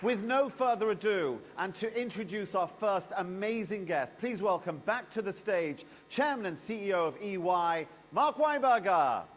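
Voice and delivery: loud, rising, stretched host intro; announcer introduction. Acted. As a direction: bring the guest on with a big build. Stage announcer introduces guest.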